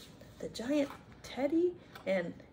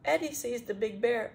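plain reading voice

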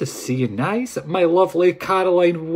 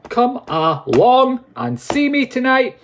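hyped invite; 9 semitones higher, 3 dB louder, slower than usual. Acted.

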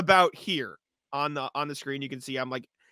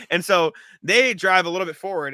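ordinary talk

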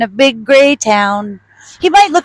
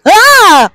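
loud high mock scream of fright; playful fake-scared shriek; startled shriek; 11 semitones higher, 7 dB louder than usual. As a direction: shriek at the snake behind you. Startled by snake behind her.

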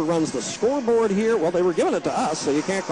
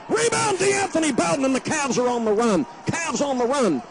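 higher, rising call of the break; fast-break excitement; excited; 8 semitones higher than usual. Real, not acted.

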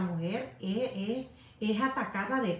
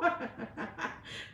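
soft breathy laugh; 7 dB quieter than usual. Real, not acted. Laughing at her own remark.

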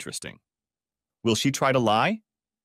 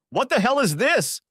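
high startled shout; 13 semitones higher, 3 dB louder than usual. Acted. Tom pinned to the floor by police, shouting. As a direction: yell it in shock. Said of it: "shouted Tom".

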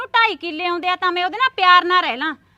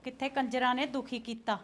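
muttering; 8 semitones lower, 17 dB quieter than usual. Acted. Muttered last words of fight.

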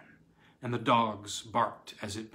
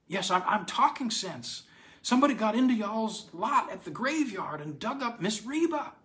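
put-on high, breathless old man's voice; frantic old man; 10 semitones higher than usual. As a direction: babble it out in panic. Frantic old man reports the grave.

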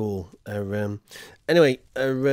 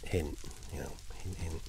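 soft much lower aside; quiet aside; 10 semitones lower, 16 dB quieter than usual. Real, not acted. Streamer nudging chat to clip it, soft aside. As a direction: nudge chat under the breath.

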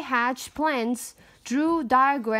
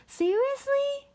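incredulous. Acted. Incredulous character aside.

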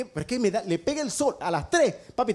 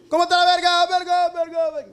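faux écho; 8 semitones higher, 6 dB louder than usual. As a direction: question criée plus haut et plus fort, répétée. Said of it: "gritás y el eco te".

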